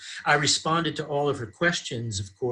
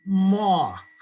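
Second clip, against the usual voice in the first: higher, hammed-up New York accent; big New Yawk vowel, New York accent; exaggerated New York accent; 7 semitones higher, 4 dB louder than usual. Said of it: "in an exaggerated New York accent".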